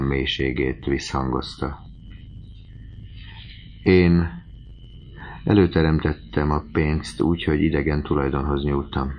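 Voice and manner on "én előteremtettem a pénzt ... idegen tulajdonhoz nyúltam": usual speaking voice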